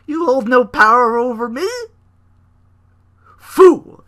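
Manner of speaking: high, loud, hammed-up cult leader; Bray Wyatt impression. Bray taunting Roman, loud mock preacher. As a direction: bellow like a creepy preacher. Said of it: "Bray's like".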